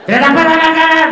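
loud, high cursing outburst; furious mom curse. Retold or imitated. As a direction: explode like a furious mother. Mother's outburst.

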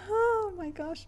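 high, drawn-out laughing exclamation; laughing delight. Real, not acted. Vlogger tells viewers about today's walk, laughing delight.

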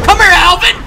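loud shout chasing an enemy. Real, not acted. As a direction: yell it after him.

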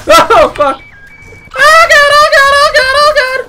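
high, loud panicked screaming; loud harsh voice. Real, not acted. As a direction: scream it out in terror. Screaming under attack.

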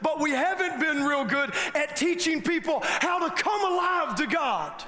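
rally the congregation; preaching at full force. Acted.

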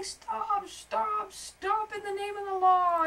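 high, loud heroic yell. A narrator's reading. Beetle racing at spider to save butterfly, yelling. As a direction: shout it out while charging in.

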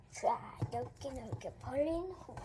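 unvoiced hushed whisper. Real, not acted. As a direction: whisper the steps. Whispered slime demo.

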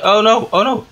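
high, loud gamer panic; rising yelp of dismay; alarmed. Real, not acted. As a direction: cry out as it goes wrong. Getting killed in the game.

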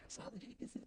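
whispered under the breath; a secretive whisper. Real, not acted. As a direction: whisper it so nobody hears. Sneaky aside while trolling friend.

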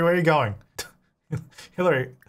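normal talking voice

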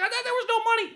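high mock voice; mocking media people. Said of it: "media people that complain, like".

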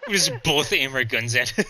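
ordinary talk

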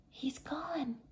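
soft unvoiced whisper. A narrator's reading. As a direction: breathe it out in a hush. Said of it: "whispered Annie".

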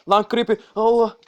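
sudden high, loud cry of alarm; startled shout. Real, not acted. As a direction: yelp as a monster jumps out. Creeper suddenly appears in game.

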